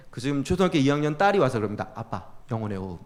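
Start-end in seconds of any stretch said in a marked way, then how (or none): 2.0-3.0 low, hushed imitation of a child's reminder; quiet, lowered retold aside; gentle reminder. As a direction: softly nudge dad, imitating his little daughter. Young daughter gently reminds angry dad.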